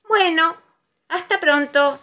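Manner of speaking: high, loud breezy goodbye; cheerful Tiger. A narrator's reading. Tiger leaving Pooh to go bouncing, cheerful. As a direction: brush it off brightly.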